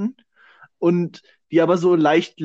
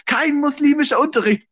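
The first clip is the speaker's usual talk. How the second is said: mock AfD slogan; 9 semitones higher, 4 dB louder than usual. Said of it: "So die A F D, so in der Türkei".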